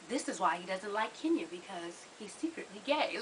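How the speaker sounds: low, very soft aside; hushed insinuation. Acted. Voicing the episode's hidden undertone, hushed and insinuating. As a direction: drop right down as if confiding a secret.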